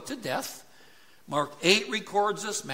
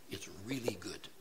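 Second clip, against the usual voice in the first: breathy whisper, just between us; whispered aside; 9 semitones lower, 12 dB quieter than usual. Real, not acted. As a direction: lean in and whisper it to the congregation. Whispered aside about soup recipe.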